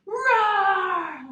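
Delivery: slow, loud, growling bear roar. Shown as a demonstration. Yoga teacher roars like a bear with the kids. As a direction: let it rip like a big bear. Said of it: "roar like a bear".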